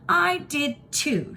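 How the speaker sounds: high, slow, stubborn insistence; belligerent. A narrator's reading. Kirsty insisting to her sister she saw fireworks. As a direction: dig in like an offended child. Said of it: "she said belligerently".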